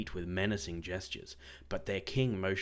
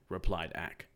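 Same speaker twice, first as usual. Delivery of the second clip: usual speaking voice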